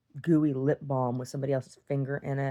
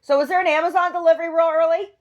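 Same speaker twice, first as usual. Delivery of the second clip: much higher and louder call down the drive; 10 dB louder than usual. Real, not acted. Calling down to the early delivery driver, loud. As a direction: shout it out to someone far below.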